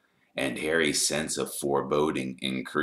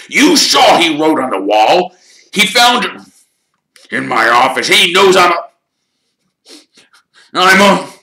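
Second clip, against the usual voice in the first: high, loud ranting that breaks off; rage, then stumble before the confession; frantic accusation; 17 dB louder than usual. A narrator's reading. Caretaker accusing Harry in front of all, frantic and loud. Filch rants, faltering at confession.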